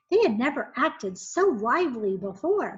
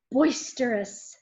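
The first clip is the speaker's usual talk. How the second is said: plain reading voice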